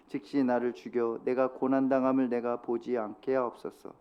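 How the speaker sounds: 낮고 느린 엄숙한 성경 낭독; 모세의 탄식을 차분히 읽어 내려가듯. Acted. Reads scripture passage aloud. (회중과 함께 모세의 기도문을 낮고 엄숙하게 낭독)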